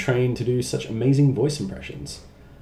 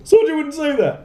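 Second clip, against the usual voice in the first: much higher, louder mock voice; high whiny friend impression; mimicking friend; 6 dB louder than usual. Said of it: "He's like".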